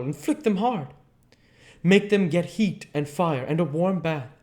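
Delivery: put-on high, loud, commanding old professor; Van Helsing voice. Professor ordering his friend to wake the maids, high loud urgent commands. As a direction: drive the orders home, no time to lose.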